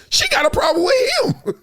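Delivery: jumps way up and shouts the point; sudden high shout. Real, not acted. Host to the audience about Beadle, shouting high. Calling out Michelle Beadle's problem.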